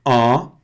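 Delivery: nasal "An" with a straight unsmiling mouth; serious an. Shown as a demonstration. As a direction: say "An" seriously, no smile. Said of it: "faz o an primeiro sério". (an nasal com boca séria)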